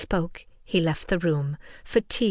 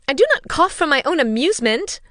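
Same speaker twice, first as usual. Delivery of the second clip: high, louder fretful protest; 8 semitones higher, 7 dB louder than usual. A narrator's reading. Kitty answering her father's teasing, fretful and loud. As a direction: whine back in a hurt voice. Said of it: "replied Kitty fretfully".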